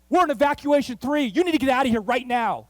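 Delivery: loud, high urgent command; officer at the door ordering them out, urgent and loud. Retold or imitated. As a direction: bark the evacuation order. Police officer orders evacuation.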